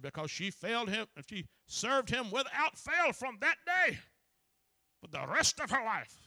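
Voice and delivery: high, loud preacher's cry quoting another man; impassioned retelling. Retold or imitated. Preacher quoting Moses on his mother, impassioned shout. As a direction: belt out the retold testimony, stretched and high. Retells a tearful testimony at full force.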